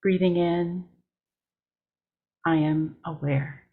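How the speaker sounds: measured meditation read with long pauses between lines; steady mindful guiding voice, line by line; guided meditation. Acted. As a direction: lead the breathing lines unhurried, letting each settle. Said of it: "I will begin with a guided meditation".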